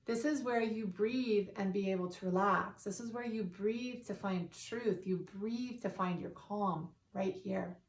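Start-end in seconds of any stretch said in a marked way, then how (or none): none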